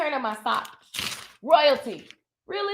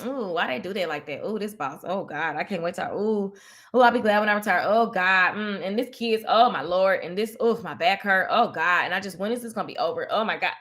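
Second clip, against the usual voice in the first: lower, groaning whiny complainer; mock complaining; 7 semitones lower than usual. Mimicking people who wake up complaining, lower and groaning. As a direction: moan each gripe like a worn-out worker. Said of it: "They wake up".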